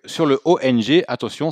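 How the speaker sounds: ordinary talk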